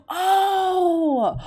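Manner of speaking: loud high gasp then oh; gasping shock. Real, not acted. Shocked by a strange quiz option, loud high gasp.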